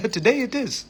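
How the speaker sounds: high, mock-British reply; cheeky put-on London accent, close to giggling; fake British accent. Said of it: "Is this your real accent?".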